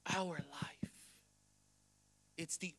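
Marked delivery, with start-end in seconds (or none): 0.1-0.9 sudden drop into a whisper. Real, not acted. Pastor ending his plea to God, whispered. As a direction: finish the prayer in a low whisper. Shouted prayer drops to whisper.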